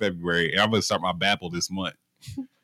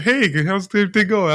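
higher, breezy mock-friendly greeting; imitating the boss; 9 semitones higher than usual. Telling friends how the boss greeted him, mock-breezy. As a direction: lightly put on the boss's casual hello. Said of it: "He said".

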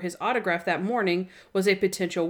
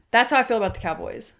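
ordinary talk

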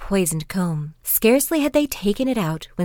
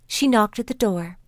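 ordinary delivery

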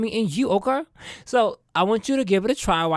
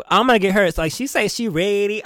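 usual speaking voice

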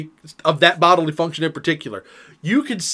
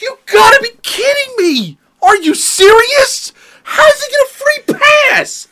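much higher, loud, cracking disbelief; outraged shout; 8 dB louder than usual. Retold or imitated. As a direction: yell it like the verdict is unfair. Outraged at sister's free pass.

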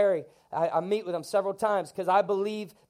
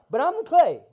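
shouted refrain; 9 semitones higher, 9 dB louder than usual. Acted.

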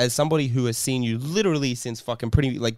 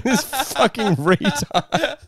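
slow sneer broken by laughter; laughing mock sneer; 12 semitones higher, 6 dB louder, much slower than usual. The confused sisters sneering at his tears, slow laughing mock contempt. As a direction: drag it out while cracking up. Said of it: "They were like".

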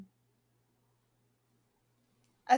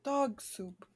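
normal talking voice

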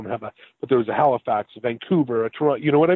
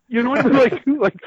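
laughing; 11 semitones higher, 4 dB louder than usual. Real, not acted. Laughing over band's bad timing.

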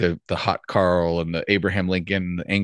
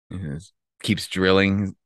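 usual speaking voice

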